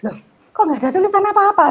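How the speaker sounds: high, loud shocked cry; surprised exclaim. Retold or imitated. Mother shocked the child's book has nothing written in it, high loud exclaim. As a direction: exclaim in disbelief at the blank book.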